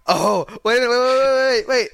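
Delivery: high, loud rush of waits; excited interruption. Real, not acted. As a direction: cut in urgently, high and loud. Interrupts on finding who nominated it.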